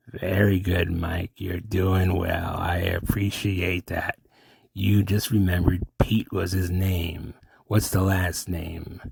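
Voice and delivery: slow, measured eerie approval; Mystery Man. Acted.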